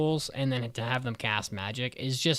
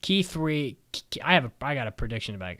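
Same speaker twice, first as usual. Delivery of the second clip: ordinary talk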